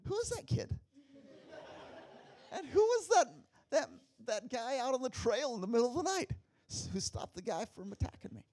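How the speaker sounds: high, marvelling questions; awed high-pitched wonder; wondering aloud. Retold or imitated. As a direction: ask in amazement. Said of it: "later I thought".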